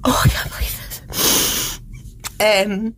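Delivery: whispered through real tears, slow; barely holding it together, breathing the words out; crying whisper. Real, not acted. Overwhelmed at transfer news.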